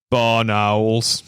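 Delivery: big, drawn-out mock bellow. Friend steps in to save him from a nosy walker, bellowing. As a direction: proclaim it slowly in a big imposing voice. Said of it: "this bellowing voice just go".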